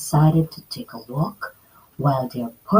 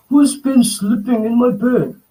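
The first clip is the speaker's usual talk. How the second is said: a touch of a louder roaring Papa Bear; mild roar; 6 semitones higher, 4 dB louder than usual. Acted. Papa Bear checking his bed, mild roar. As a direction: roar it lightly. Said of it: "Papa Bear roared".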